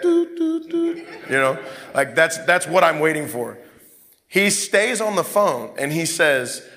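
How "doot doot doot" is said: high, level dial-tone beeps; high monotone "doot" beeps; imitated hang-up tone. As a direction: mimic a phone line going dead. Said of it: "and you hear that".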